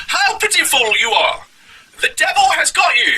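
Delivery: high, drawn-out mock preacher. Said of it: "here it is from Cleveland Street Preachers".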